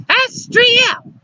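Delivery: very high indignant call. A narrator's reading. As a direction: call his name sharply after him. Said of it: "Luciana raising her voice".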